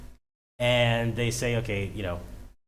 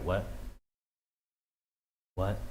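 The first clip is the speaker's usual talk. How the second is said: usual speaking voice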